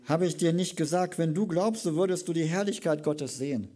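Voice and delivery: loud preacher's proclamation; proclaiming scripture. Acted. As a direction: proclaim it to the whole crowd. Resumes scripture reading.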